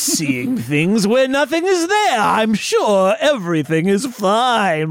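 slow, high, stretched villain voice; villainous actor impression. Official calling everything into question, drawn-out villain voice. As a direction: draw every word out sinisterly. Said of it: "Why are you talking like that?".

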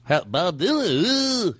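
slow, rising mock [name] croon; bad [name]-like [name]. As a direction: ham a bad [name] impression lightly. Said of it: "doing a bad, like, [name]".

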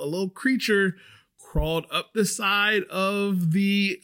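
ordinary talk, nothing marked

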